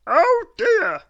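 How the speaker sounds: very high, loud, daft man's voice; a high, loud, dotty "oh dear"; silly Mister Muddle. A narrator's reading. Mister Muddle finding nobody home, high and loud.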